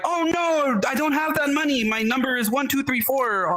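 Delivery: high, flustered mock panic; a gullible caller blurting out numbers; hammed-up alarmed victim; panicked victim. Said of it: "a thousand other people that be like".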